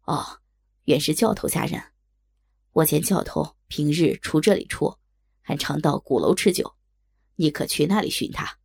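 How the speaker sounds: deep-pitched helpful stranger; kindly passerby. A narrator's reading. Passerby telling the maid where to look, helpful. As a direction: give directions in a low stranger's voice.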